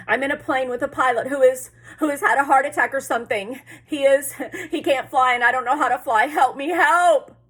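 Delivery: loud, high, stumbling panic; panicked pleading. A narrator's reading. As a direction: blurt it out, then cry out for help. Boy pleading for help by radio.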